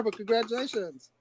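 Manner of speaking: usual speaking voice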